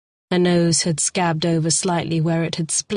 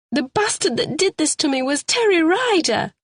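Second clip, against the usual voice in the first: pitched-up, pleading woman's voice; a frightened suspect begging to be believed; Carol, distressed woman; 11 semitones higher than usual. A narrator's reading. Suspect pleads her story to the sergeant, high and pleading.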